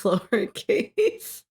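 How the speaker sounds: ordinary talk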